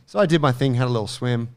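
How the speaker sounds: usual speaking voice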